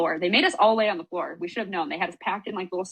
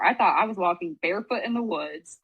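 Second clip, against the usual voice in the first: usual speaking voice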